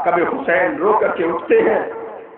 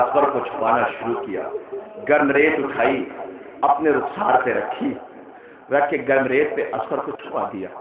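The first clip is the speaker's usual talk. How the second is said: much lower, soft grieving storytelling; hushed lament narration; 7 semitones lower, 5 dB quieter than usual. Acted. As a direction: pull the mourners close and speak low. Hushed telling of the burial.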